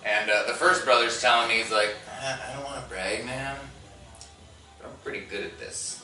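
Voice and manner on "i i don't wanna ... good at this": soft, offhand humblebrag; acted-out brother, quietly pleased with himself. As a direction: drop your voice and brag casually. Brother's modest boast acted out.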